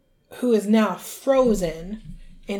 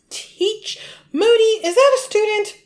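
high, loud, incredulous questioning; appalled disbelief; 12 semitones higher, 6 dB louder than usual. Retold or imitated. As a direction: play a horrified teacher confronting a colleague. Acted appalled teacher confronting Moody.

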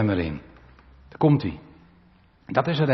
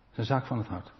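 quiet repeat; 3 semitones lower, 9 dB quieter than usual. Shown as a demonstration.

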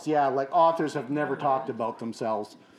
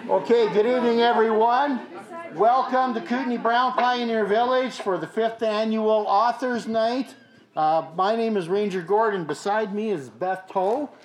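higher, loud emcee greeting; MC welcome; 6 semitones higher, 3 dB louder than usual. Acted. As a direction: greet the crowd like a host. MC welcoming the audience.

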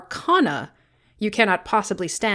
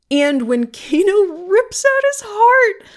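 gleeful relish; 11 semitones higher, 4 dB louder, slower than usual. Real, not acted. Gleefully recounting the gory scene.

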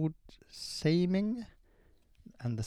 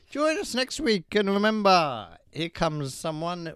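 mock announcer sign-off; 5 semitones higher, 4 dB louder, slower than usual. Mock grand show sign-off.

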